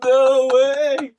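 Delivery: high, drawn-out amazed reaction; delighted disbelief. Real, not acted. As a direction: react in gleeful disbelief at the punchline. Listener reacting to bag story punchline.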